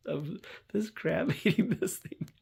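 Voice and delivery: high, slow, laughing. Real, not acted. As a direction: giggle through the words. Laughing while telling.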